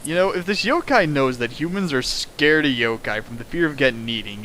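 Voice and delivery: ordinary delivery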